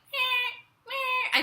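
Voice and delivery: high thin imitated meows, a faint kitten cry; mock kitten mewing. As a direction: mew like a tiny crying kitten, way up high.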